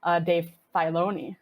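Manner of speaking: ordinary talk, nothing marked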